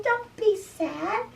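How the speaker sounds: high little mouse voice; high-pitched, coaxing storybook mouse; reassuring mouse. Acted. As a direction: comfort a sad friend in a high squeak. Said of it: "so the mouse is telling him".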